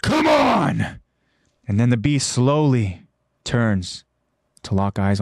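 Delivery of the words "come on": high, frustrated yell. Retold or imitated. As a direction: shout it out in frustration. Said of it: "he yells out".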